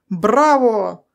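loud, jubilant cheer; enthusiastic shout. A narrator's reading. Doctors applauding Meyer's first steps, enthusiastic shout. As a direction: cheer out in delight. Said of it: "восторженно закричали врачи".

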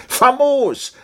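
loud, very high exclamation; delighted cry. A narrator's reading. Captain delighted by the idea, crying out high. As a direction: call it out with sudden joy. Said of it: "rief der Hauptmann".

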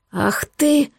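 explode, but keep volume contained; understated outraged cry; mild roar. A narrator's reading. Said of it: "взревел я".

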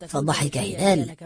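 usual speaking voice

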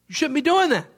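high, indignant mock scolding; acted indignant anger. Furious master to the unforgiving servant, indignant reproof. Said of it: "he is really mad".

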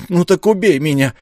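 high, loud challenge; a desperate shout of defiance. Acted. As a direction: dare him to strike, shouting. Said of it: "крикнул Ра".

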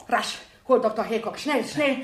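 loud, rushed orders; urgent calling. Acted. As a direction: hurry him off to fetch the doctor. Emergency, sends for the doctor.

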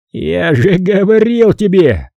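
slow, high, loud gloating bark; triumphant bark. A narrator's reading. Charlie gloating at Maxine that he was right, triumphant bark. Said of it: "рявкнул Чарли с выражением триумфа".

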